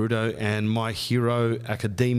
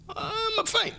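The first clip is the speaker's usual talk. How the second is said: high, drawn-out faint wail; comic high swooning panic; mock panic; slower than usual. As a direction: squeal it slowly as if about to pass out. Retold panic at police visit.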